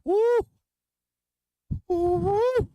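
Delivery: high, loud whooping cheers; excited whoops. Real, not acted. Drinking on stream, whooping at chat in glee. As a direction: whoop it up after the shot.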